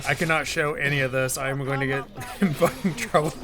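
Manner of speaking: usual speaking voice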